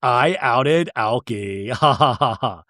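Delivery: slow, drawn-out quip with a mock laugh; self-amused gloating. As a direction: imitate someone tickled by their own joke, then cackle. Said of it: "where Lisa goes".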